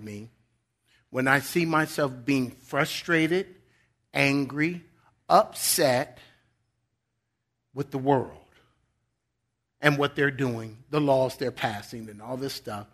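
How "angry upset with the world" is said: ordinary talk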